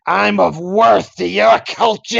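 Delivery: high, slow put-on girlish voice; a hammed-up girl posing for a selfie; mock teenage girl. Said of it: "Willem Dafoe playing a teenage girl".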